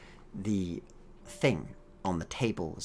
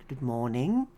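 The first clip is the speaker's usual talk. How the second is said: higher-pitched, rising good morning; careful greeting; 7 semitones higher, 5 dB louder than usual. Acted. Scientist repeats her greeting to the synthetic, high and lilting. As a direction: greet a confused patient, pitched up.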